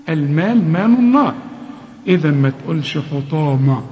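normal talking voice

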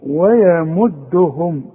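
ويمدهم very slow with light و and ي; slow thin recitation. Shown as a demonstration. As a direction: draw out ويمدهم thinly. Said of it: "اوعى تفتح لي الميم".

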